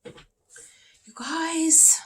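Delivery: whispered you guys, at a loss; whispered exasperation. Real, not acted. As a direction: plead to the audience in a whisper. Stuck for an answer.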